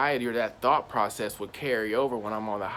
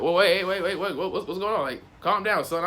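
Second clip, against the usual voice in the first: a cop trying to settle a frantic man; officer hurriedly telling a panicking man to calm down; startled cop; 7 semitones higher than usual. Retold or imitated. Voicing the cop telling him calm.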